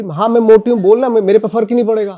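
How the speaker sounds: loud, high, proud declaration; defiant proud quote. Retold or imitated. Bharti quoted to the host, loud and defiant. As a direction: fling it out boldly, unashamed. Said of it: "वो बोली".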